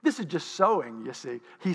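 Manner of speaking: normal talking voice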